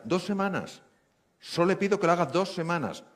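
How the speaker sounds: doctor firmly insists the patient try for two weeks; firm insistence. Retold or imitated. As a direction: plead firmly, stressing the request.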